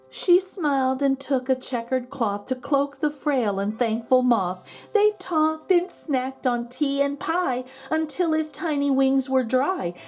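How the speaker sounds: ordinary delivery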